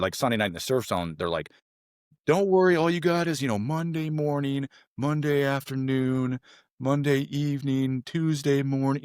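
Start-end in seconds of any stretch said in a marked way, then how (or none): 2.3-9.0 mock taunting. Instructors to trainees in the surf zone, mock taunting.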